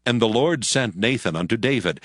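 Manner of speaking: usual speaking voice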